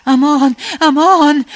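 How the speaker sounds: high, loud repeated plea; wailing plea. A narrator's reading. Overpowered soldier pleading to his captor, wailing. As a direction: wail out for mercy over and over. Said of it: "فقط یه بند مینالید و میگفت".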